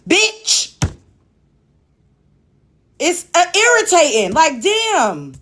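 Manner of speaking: exasperated host asserting control; real irritated rant. Real, not acted. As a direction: lay down the law to people talking over you. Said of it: "it's so fucking irritating".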